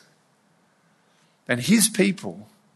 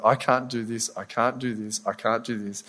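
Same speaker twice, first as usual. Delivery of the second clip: softer, deflated repeated I can't; self-doubt; 3 semitones lower, 7 dB quieter than usual. Retold or imitated. Preacher voicing his inner doubt, fading and deflated. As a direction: let each I can't sink quieter.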